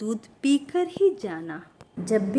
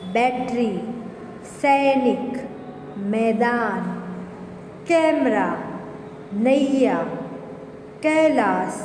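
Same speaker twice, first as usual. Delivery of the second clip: classroom word drill; slower than usual. Shown as a demonstration. Teacher drills ऐ words.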